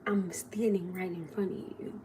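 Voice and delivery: inner thought. Retold or imitated. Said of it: "in my mind, I'm saying".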